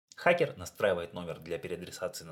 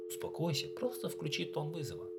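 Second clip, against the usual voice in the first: friendly reassuring; 8 semitones higher, 4 dB quieter than usual. Acted. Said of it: "дружеским тоном".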